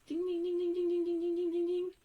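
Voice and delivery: high, sing-song bell dings, well above her voice; ringing bells. Shown as a demonstration. Teacher to class, warning of an exception with high bell dings. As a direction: chime like a little alarm bell going off. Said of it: "This has to set off little bells".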